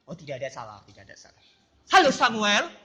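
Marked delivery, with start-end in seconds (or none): 0.1-1.4 soft reassurance. Acted. Reassuring student, whispered.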